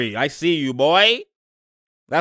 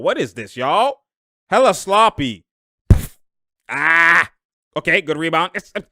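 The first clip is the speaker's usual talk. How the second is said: usual speaking voice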